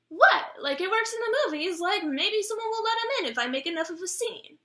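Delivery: high-pitched mock innocence; defensive protest. Explaining the scene to an embarrassed mom, defensive high voice. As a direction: protest it high, as if it's perfectly reasonable.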